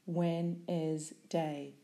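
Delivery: "Wednesday" pronounced carefully with every syllable clear, slow; split into syllables. Shown as a demonstration. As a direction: say "Wednesday" slowly, syllable by syllable: Wed-nes-day.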